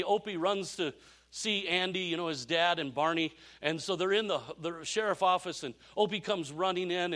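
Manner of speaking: ordinary delivery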